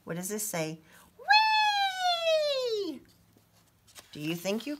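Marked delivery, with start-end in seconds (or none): 1.2-3.0 very high, long whee; gleeful whee. Acted. Leaf boarding down the hill, gleeful. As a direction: sing out the thrill of the slide.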